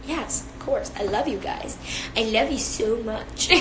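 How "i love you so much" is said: put-on cutesy baby talk. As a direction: coo it like a baby. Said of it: "That's my baby voice".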